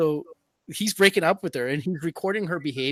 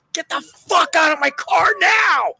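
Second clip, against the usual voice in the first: much higher and louder, yelling the quote; mock furious shout; 13 semitones higher, 11 dB louder than usual. Streamer imagining throwing her out, yelling. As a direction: roar it like you're losing your mind. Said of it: "I'd be like".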